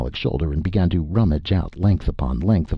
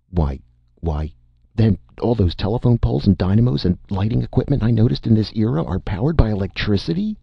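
rushed, higher and faster in disbelief; startled robot gasping at electricity; amazed; 6 semitones higher, faster than usual. Acted. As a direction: blurt it out, astonished.

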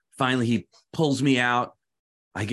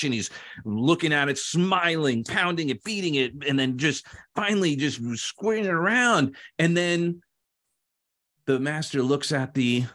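ordinary delivery

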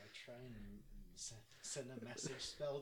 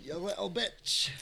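mock insult; 6 semitones higher, 6 dB louder than usual. Echoing the taunt.

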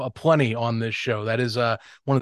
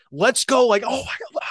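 high-pitched mock hype yell; imitating hulking out; 9 semitones higher than usual. Host imitating the player's high-motor energy on the turf, hyped-up mock outburst. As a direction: hulk out in a playful imitation of game-day energy. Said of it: "hulking, hulking out mentality".

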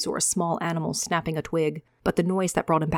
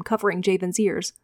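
ordinary delivery

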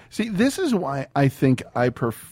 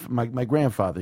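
normal talking voice

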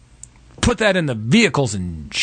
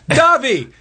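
mock action hero; 10 semitones higher, slower than usual. Actors high-fiving, mock movie line.

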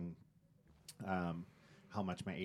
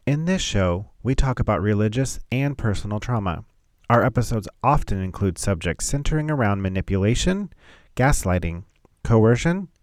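clear, measured content warning read; formal announcement; 15 dB louder than usual. Acted. Reads content warning intro.

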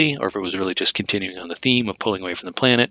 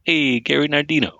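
higher, hammed-up mock Italian accent; terrible Italian accent; 6 semitones higher than usual. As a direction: put on a bad Italian accent for fun. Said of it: "That was my terrible Italian accent".

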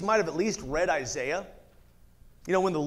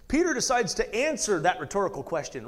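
ordinary talk, nothing marked

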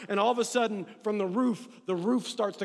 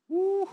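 excited whoop; 9 semitones higher, 3 dB louder than usual. Real, not acted. Preacher exults over good news.

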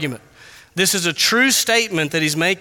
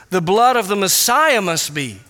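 usual speaking voice

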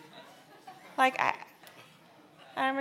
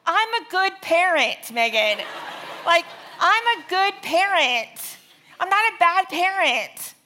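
mock indignant outburst; 9 semitones higher, 12 dB louder than usual. Pastor mocks his own self-centred reaction to babysitter, hammed-up indignation. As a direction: overact the offended parent protesting his own goodness. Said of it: "here's my response".

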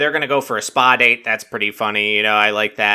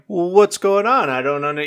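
higher-pitched mock innocent question; feigned innocence; 7 semitones higher than usual. Claire covering up after being caught making out, mock innocent. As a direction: play dumb in a raised imitating voice. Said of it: "trying to act like, as if, like, it's not obvious".

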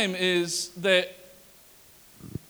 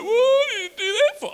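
put-on much higher, crying child; mock crying child voice; child whining; 14 semitones higher, 5 dB louder than usual. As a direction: wail like a hurt kid. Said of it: "they're like".

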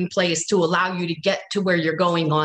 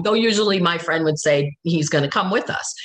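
usual speaking voice

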